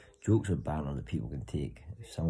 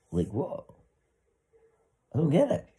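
puzzled, rising high at the end; confused; 11 semitones higher, 4 dB louder than usual. Retold or imitated. Reading the book, baffled and high-pitched. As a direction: act baffled while reading. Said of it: "I'm thinking, like".

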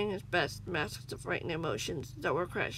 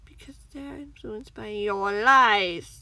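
slow sneer swelling to a loud peak; 9 dB louder, slower than usual. A narrator's reading. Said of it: "the man sneered".

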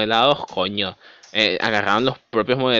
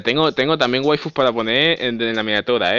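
ordinary talk, nothing marked

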